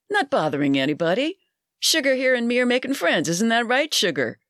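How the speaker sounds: a hint of a leering barfly; pushy barfly. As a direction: chat her up like a pushy regular. Bud insists he is friendly.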